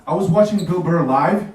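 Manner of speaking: ordinary talk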